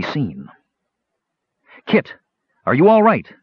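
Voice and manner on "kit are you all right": high, raised anxious call. Acted. Knight to the woman held by guards, calling out loudly. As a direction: call across to check on a companion. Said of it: "Sturm called".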